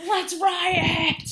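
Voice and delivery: high, drawn-out shout; mock riot-starter. Heckler rousing the school audience, mock outraged shouting. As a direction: incite the whole crowd at full volume.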